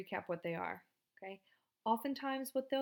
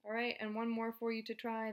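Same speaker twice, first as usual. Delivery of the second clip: usual speaking voice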